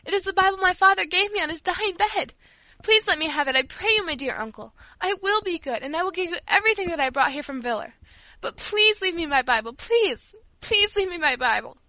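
high, urgent begging; sobbing plea. A narrator's reading. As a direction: beseech with rising desperation. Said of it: "Paula sobbed".

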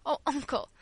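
small, acted last plea; understated, beseeching cry; imploring. A narrator's reading. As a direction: implore without raising the volume. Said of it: "she implored once more".

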